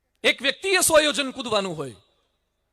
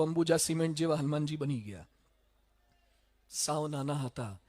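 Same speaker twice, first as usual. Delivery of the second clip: ordinary talk, nothing marked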